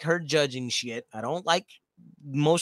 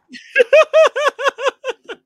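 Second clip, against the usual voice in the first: loud high laugh; 12 dB louder than usual. Real, not acted. Laughs at question.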